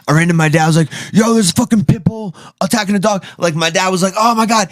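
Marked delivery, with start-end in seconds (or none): 1.1-3.2 urgent, higher and fast; alarmed shout to dad about the pit bull, high and fast; retold alarm. 4.2-4.7 high, startled gasp of a line; dad hearing about the attack, high and startled; dad's shock. Retold or imitated.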